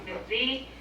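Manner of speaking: ordinary delivery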